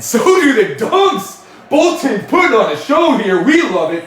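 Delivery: high, loud hyped commentary; pumped-up play-by-play; excited call. Real, not acted. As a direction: ride the energy of the dunks. Hyped by back-to-back dunks.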